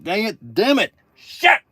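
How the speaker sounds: high, quick outbursts rising in pitch; exasperated high-pitched swearing; frustrated cursing. Pretend. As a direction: curse at your own flub. Flubs the song, curses.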